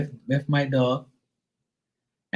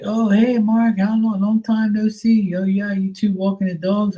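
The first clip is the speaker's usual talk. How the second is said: much higher, mock-cheery dad voice; imitating the dad; 9 semitones higher than usual. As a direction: act the dad falsely surprised to see them together. Mimicking the dad.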